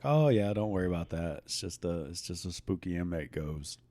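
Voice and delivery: low, soft, offhand dismissal; casual shrug in the voice; nonchalant coworker. Retold or imitated. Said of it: "how nonchalantly the coworker's just like".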